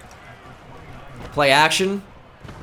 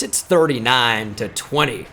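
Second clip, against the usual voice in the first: usual speaking voice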